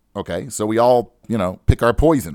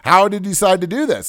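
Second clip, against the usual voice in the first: higher, louder group protest; acting out fed-up passengers complaining; aggrieved retold protest, pitched up; indignant complaining; 7 semitones higher, 4 dB louder than usual. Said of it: "everybody started complaining".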